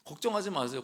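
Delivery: normal talking voice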